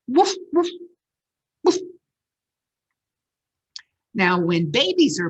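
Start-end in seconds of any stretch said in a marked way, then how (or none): 0.1-1.8 high, loud woof woofs; barking. Shown as a demonstration. Reader barking for the children, playful and high. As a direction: bark it out like a playful dog. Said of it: "They bark".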